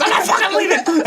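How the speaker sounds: high laughing mock shout; mock defiant yell. Coworker joking about swearing in class, laughing yell. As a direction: bellow it for the kids, laughing. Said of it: "you can swear in front of the kids".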